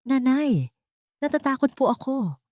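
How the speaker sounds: put-on a frightened little girl, mildly tearful; a hint of a whimpering child; scared child. A narrator's reading. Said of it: "Ang mahinang iyak ni Tiana".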